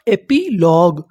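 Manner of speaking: Epilogue in parts, एपी then लॉग; measured teaching breakdown of epilogue; syllable by syllable. Shown as a demonstration. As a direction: split epilogue with a small gap before लॉग. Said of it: "meaning क्या बनी".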